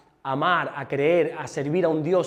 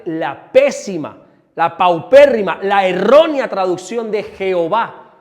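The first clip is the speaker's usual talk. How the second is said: slow, louder scorn on each adjective; scornful emphasis; 7 dB louder, slower than usual. Real, not acted. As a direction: hammer "pésima", "paupérrima", "errónea" in turn. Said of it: "condemning the bad translation". (recalcando con desprecio cada adjetivo)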